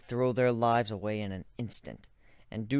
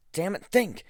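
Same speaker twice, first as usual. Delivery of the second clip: sharp, higher frustrated push; frustrated self-urging; 8 semitones higher, 4 dB louder than usual. Acted. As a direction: snap at yourself to think.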